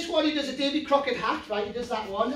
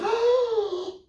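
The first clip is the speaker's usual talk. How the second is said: inflating gasp; 10 semitones higher than usual. Acted. Blowing puppet back up.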